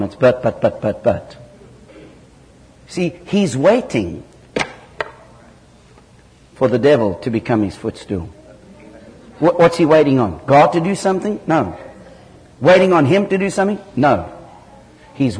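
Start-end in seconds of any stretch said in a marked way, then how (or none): none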